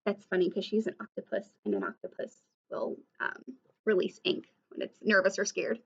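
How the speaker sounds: ordinary delivery, nothing marked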